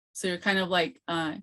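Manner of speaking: ordinary talk, nothing marked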